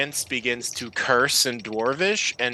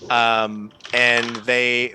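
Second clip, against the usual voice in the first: ordinary talk, nothing marked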